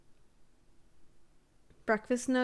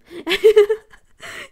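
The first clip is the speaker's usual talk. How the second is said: bright high laugh; 12 semitones higher, 16 dB louder than usual. Real, not acted. Laughs at own spring confusion.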